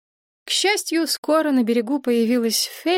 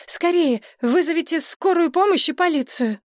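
high, panicky call for help; urgent blurt; 8 semitones higher than usual. A narrator's reading. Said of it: "выпалила Мария".

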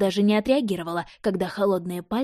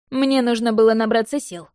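louder smug smirk; 3 semitones higher, 6 dB louder than usual. A narrator's reading. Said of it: "ухмыльнулся Вейн".

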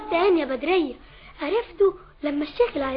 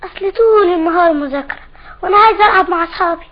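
put-on much louder protest from a child; loud childish complaint; 9 dB louder than usual. Child who wants to play with friends, loud complaint. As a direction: raise your voice and complain to mum.

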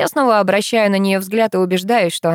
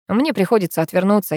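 ordinary delivery, nothing marked

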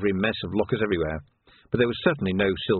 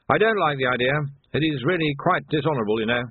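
higher, put-on officer's voice; reluctant captain; 5 semitones higher than usual. Captain agrees to the search, reluctant officer's voice.

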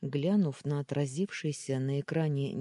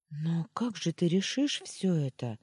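bewildered; 4 semitones higher than usual. Acted. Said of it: "растерянно спросила я".

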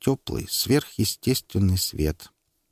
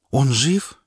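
high, urgent question; anxious question; 5 semitones higher, 4 dB louder than usual. Acted. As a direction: ask it in alarm. Woman anxiously asks.

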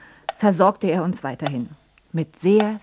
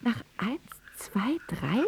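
soft whispered question; 4 semitones higher, 8 dB quieter, slower than usual. Acted. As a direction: whisper the count. (geflüstert)

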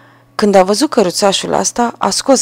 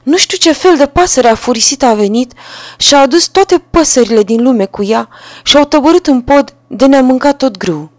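upset wife; 6 semitones higher, 5 dB louder than usual. A narrator's reading. Wife reports the birds.

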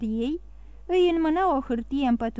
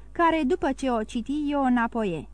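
usual speaking voice

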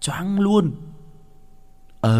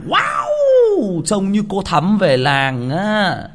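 mock wow; 6 semitones higher, much slower than usual.

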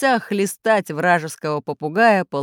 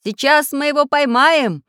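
loud, raised, confident promise; eager call; 7 semitones higher, 6 dB louder than usual. A narrator's reading. Inventor stepping on the gas, eagerly calling. Inventor calls out during chase.

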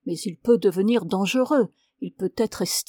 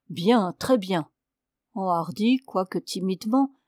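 usual speaking voice

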